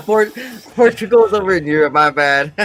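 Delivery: high, loud laughing admission; laughing at himself. Real, not acted. Caught saying Portugal is close, laughing high and loud. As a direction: laugh through your own blunder. Realizes his geography mistake, laughing.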